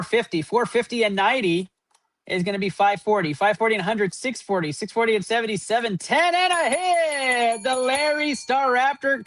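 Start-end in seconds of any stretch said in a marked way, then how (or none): 6.3-9.2 higher, slowed-down hype announcement; excited reveal. Real, not acted. Host announcing a hit to his live viewers, thrilled and drawn out. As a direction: savor the pull as it comes out.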